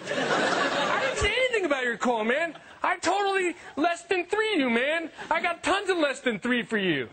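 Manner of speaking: high, pleading dude voice; earnest texter. Retold or imitated. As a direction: beg your buddy to understand. Texter defends himself, earnest.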